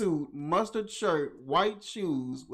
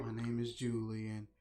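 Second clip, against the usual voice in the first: low, soft, deadpan witness statement; flat calm imitation; 7 semitones lower, 9 dB quieter than usual. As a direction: say it like it's nothing at all. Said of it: "That's how she was talking".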